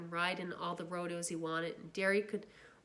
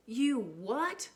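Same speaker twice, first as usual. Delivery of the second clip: loud, higher disbelieving shout; 5 semitones higher, 4 dB louder than usual. Acted. Brother shouting at the boy who slept in the lot. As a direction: yell it in angry disbelief. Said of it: "he was shouting".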